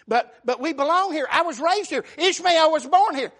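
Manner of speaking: high, loud desperate plea; pleading cry. Acted. Hagar begs Abraham to let her stay, crying out loud. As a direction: beg to stay, voice rising.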